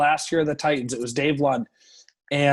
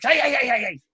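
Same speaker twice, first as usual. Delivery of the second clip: much higher taunting hey hey; mock tough guys; 9 semitones higher, faster than usual. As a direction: fire off the heys high like goading a player. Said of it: "were like".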